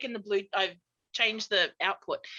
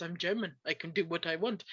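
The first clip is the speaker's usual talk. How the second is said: low, quiet German dad, sounding a bit Russian; soft mock German accent, pitched down; dad's attempted German accent; 6 semitones lower, 7 dB quieter than usual. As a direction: imitate a deep, unbothered German father. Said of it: "try to make him sound Russian".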